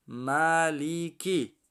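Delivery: measured teaching read of ملیکی; syllable by syllable. Shown as a demonstration. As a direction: stretch each syllable of ملیکی. Spells word syllable by syllable.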